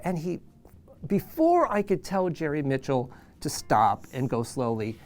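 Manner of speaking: ordinary talk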